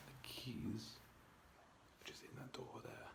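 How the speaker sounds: soft hushed whisper. Real, not acted. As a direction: whisper so nobody wakes. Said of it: "which is why I'm whispering".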